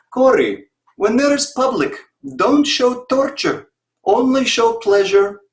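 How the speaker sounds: hammed-up Russian coach giving stage advice; mock Russian accent. Coach tells Corey to hide the pain in a mock Russian accent. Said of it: "terrible Russian accent".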